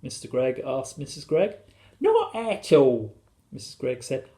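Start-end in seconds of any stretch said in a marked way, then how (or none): 2.0-3.1 high, drawn-out airy reply; high storybook lady; wife's voice. A narrator's reading. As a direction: answer in a high, blissful voice. Wife's blissful answer.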